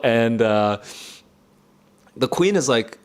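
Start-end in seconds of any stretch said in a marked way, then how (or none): none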